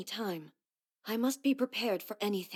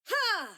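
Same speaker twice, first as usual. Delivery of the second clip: sharp attack shout; 12 semitones higher than usual. Acted. Attack grunts in battle.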